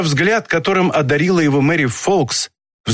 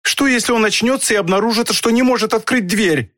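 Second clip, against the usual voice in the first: high-pitched, horrified protest; wife's dismayed outcry, pitched high; distressed cry; 7 semitones higher than usual. A narrator's reading. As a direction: plead against it in alarm. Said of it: "вскричала Мэри Фолкс".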